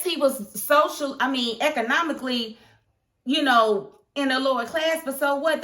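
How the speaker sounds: heated rant. Real, not acted. Fan scolds the boss character, heated and loud. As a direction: tell him off, heated and rising.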